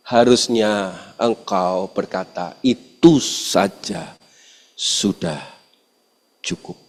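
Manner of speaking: preacher softly quoting the verse that calmed him; soft slow scripture. Acted. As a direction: hush it like a revelation.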